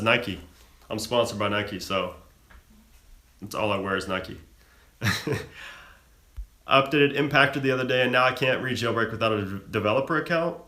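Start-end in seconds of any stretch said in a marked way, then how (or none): 5.0-5.7 short laugh. Real, not acted. Streamer amused after Nike sponsor remark, short laugh. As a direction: give a short little chuckle.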